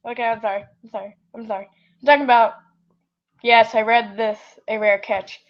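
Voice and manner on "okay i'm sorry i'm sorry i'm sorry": quiet apology. Real, not acted. Apologizing softly after yelling.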